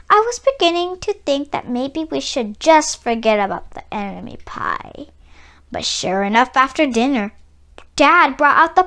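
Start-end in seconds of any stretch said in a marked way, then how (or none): 3.7-5.1 whispering. A narrator's reading. Narrator hints at secret.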